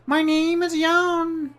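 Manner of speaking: very high, slow, squeaky sprite voice; a tiny bird-sized helper, cheerful; chirpy little creature. Acted. Jan introduces himself to the traveller, high and chirpy.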